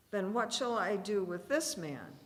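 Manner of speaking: ordinary delivery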